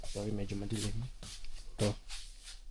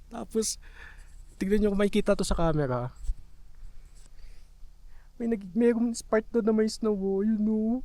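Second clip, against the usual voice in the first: high, eager and raised; excited; 9 semitones higher than usual. Real, not acted. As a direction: squeal with longing for snow. Eager to play in snow.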